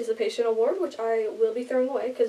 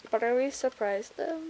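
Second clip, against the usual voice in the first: normal talking voice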